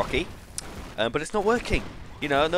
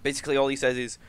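ordinary delivery

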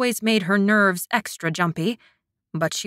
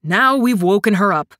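louder, irritated reproach; growling; 7 dB louder than usual. A narrator's reading. Kai blaming his friend for waking her, growling. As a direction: scold him crossly. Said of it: "he growled".

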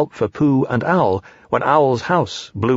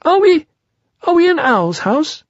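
very high, hesitant little voice; high squeaky question; Piglet bewildered; 5 dB louder than usual. Acted. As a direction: ask as a bewildered small animal. Piglet lost after the fall.

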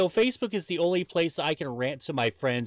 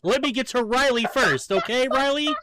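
higher, louder, calling over the others; exasperated shout; 10 semitones higher, 4 dB louder than usual. Real, not acted. Host telling a co-host to wait his turn, exasperated shout. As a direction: shout him down so you can finish.

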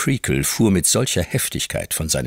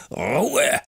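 bellowing, higher; thundering; 9 semitones higher than usual. A narrator's reading. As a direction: thunder it at the class. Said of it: "mit Donnerstimme".

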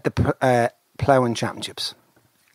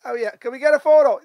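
higher, louder excited greeting; excited call; 13 semitones higher, 4 dB louder than usual. Retold or imitated. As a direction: call the name out, delighted. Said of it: "he goes".

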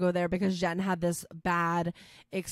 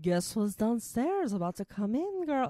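fake French accent; 4 semitones higher than usual. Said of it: "fake French accent or something".

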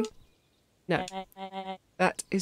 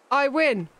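triumphant call; 5 semitones higher, 4 dB louder than usual. Acted. Winner calls out after race.

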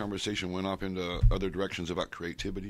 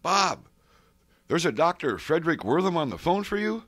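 mom calling up the stairs, much higher and louder; 10 semitones higher, 7 dB louder than usual. Retold or imitated. Mother shouting to her son that the phone is for him, high and loud. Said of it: "My mom goes".

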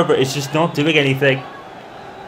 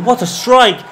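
higher, louder thrilled cry; excited; 6 semitones higher, 4 dB louder than usual. Real, not acted. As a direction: let the praise burst out. Replay of the goal.

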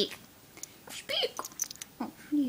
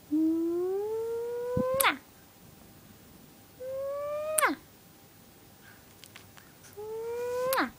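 drawn-out, high-pitched mwah kisses; a squeaky, building kiss noise ending in a smack; long high kiss "mwah"; 12 semitones higher, much slower than usual. Real, not acted. Owner smothering her dog with kisses, drawn-out high mwah.

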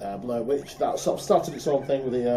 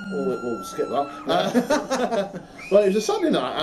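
laughing; 10 semitones higher than usual. Real, not acted. Embarrassed about homemade films.

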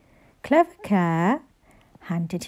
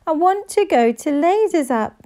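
wailing; 8 semitones higher, 4 dB louder than usual. A narrator's reading. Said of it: "wailed Henry".

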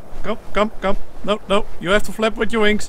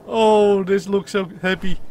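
ordinary talk

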